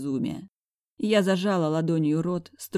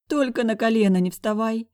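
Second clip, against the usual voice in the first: faint moan; 7 semitones higher, 5 dB louder than usual. A narrator's reading. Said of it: "обморочно простонала я".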